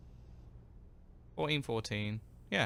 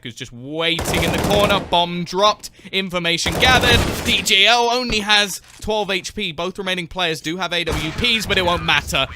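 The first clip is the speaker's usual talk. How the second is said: louder, higher rising commentary; excited play-by-play; 6 semitones higher, 9 dB louder than usual. Acted. Caster calling the comeback win, excited and loud. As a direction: call the round's finish, building hype. Climax of live casting.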